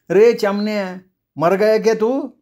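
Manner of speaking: high, irritated yell at her son; angry shout. Acted. Ruka's wife scolding her sleeping son, angry high yell. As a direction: snap him awake with a sharp cry. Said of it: "रुकाची बायको चडफडून ओरडली".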